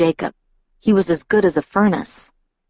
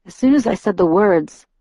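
ordinary delivery

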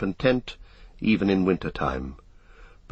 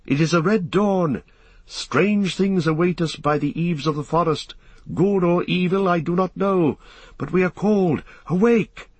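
high, urgent proclamation to waking companions; rousing elf's call in a much raised pitch; crying out; 10 semitones higher than usual. A narrator's reading. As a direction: call it out high, ending on a ringing cry. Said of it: "he cried".

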